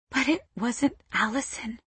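soft, small protest; hushed, hesitant objection; murmur. A narrator's reading. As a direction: murmur it under your breath. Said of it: "I murmur".

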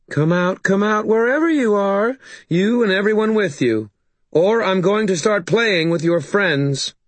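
high, taunting call; Maeve. A narrator's reading. Maeve threatening those hiding inside, high taunting call. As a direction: call them out in a high, menacing tease. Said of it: "Maeve called from outside".

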